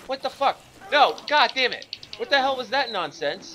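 loud, high angry yelling; furious outburst. Real, not acted. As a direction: rage at the game. Hit by enemy in game.

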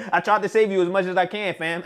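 fast, high, laughing through words; laughing tease. Real, not acted. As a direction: giggle it out. Laughing while teasing.

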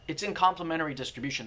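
ordinary talk

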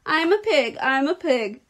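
high, loud playful chant; boisterous yelling. A narrator's reading. Boy playing pig in the crate, boisterous. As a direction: shout it like a show-off kid.

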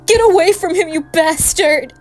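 very loud, high-pitched enraged yell; furious shout. Acted. Parent snapping at the monster standing over James, furious shout. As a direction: explode at full volume to drive them off.

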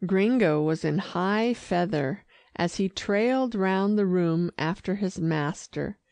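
plain reading voice

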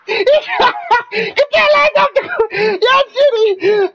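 very high strained yell, slow on the last words; crying shout. Real, not acted. Streamer screaming at bad teammates to get off the court. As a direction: scream it out half laughing, half crying.

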